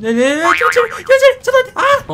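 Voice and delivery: high, loud terrified cry; panicked shriek. Real, not acted. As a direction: shriek in sudden disgust. Slug jumps out. (悲鳴)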